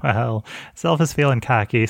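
ordinary delivery

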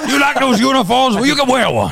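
very high, loud, hammed-up impression. Co-host badgering [name] as the interviewer, terrible impression. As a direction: shout it in a bad ranting-host voice. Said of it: "That's a terrible [name]".

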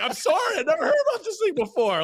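high, fast mock protest; reenacted flustered comeback; defensive protest. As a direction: plead ignorance in a very high pitch. Acting out own defensive reply.

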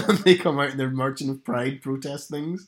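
usual speaking voice

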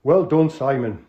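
drawn-out Geordie host; Geordie accent. Acted. Tony checking the crooks have tea, Geordie. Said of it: "an authentic, uh, Northerner".

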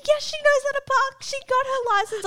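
high, sharp imitated teacher snapping back; mock teacher retort. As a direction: fire it back like an exasperated teacher.

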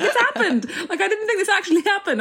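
high, quick, laughing excitement; excited mum. Retold or imitated. Mum insisting to her child that it happened, excited imitation. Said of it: "My mum was really excited".

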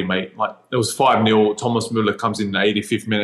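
ordinary delivery

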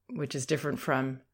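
ordinary talk

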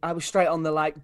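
usual speaking voice

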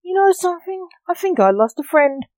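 usual speaking voice

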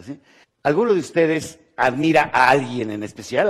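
ordinary delivery

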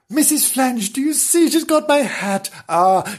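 high, excited squeal of joy; delighted. Acted. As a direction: gush to the wife in high, hammed-up delight. Husband delighted cow has his hat.

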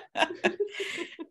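light airy giggle; quiet high laugh; high soft laugh. Real, not acted. Laughs at steroid joke.

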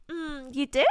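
hushed icy reply; quiet cold retort. Retold or imitated. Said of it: "I was like".

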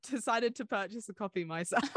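ordinary delivery

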